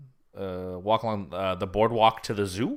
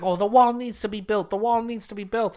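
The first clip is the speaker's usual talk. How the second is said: much higher, insistent mock hairdresser in an Eastern European accent; 11 semitones higher, 5 dB louder than usual. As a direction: repeat the demand in a put-on Eastern European accent. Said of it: "Eastern European accent".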